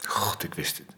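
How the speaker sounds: whispered, broken by the news; despairing whisper. Acted. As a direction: breathe it out in quiet despair. Husband hears wife won't wake. (gefluisterd in wanhoop)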